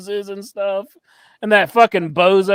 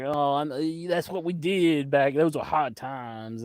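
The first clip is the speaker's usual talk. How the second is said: Dusty Rhodes; 4 semitones lower, 7 dB quieter than usual. Retold or imitated. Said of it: "He's just like".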